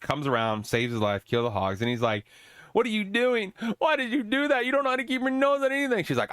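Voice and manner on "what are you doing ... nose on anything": much higher, petulant mocking prince voice; whiny prince. Prince mockingly scolds the woman who saved him, whiny. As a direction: sneer at her like a spoiled brat.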